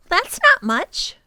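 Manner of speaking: put-on a girl unimpressed; high child voice; blunt little girl. Lindy blunt.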